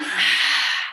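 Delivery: loud rush of breath out; forceful pumped exhale. Shown as a demonstration. Yoga teacher demonstrating breath of fire slowly, forceful exhale. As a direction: push all the air out hard. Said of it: "pull in".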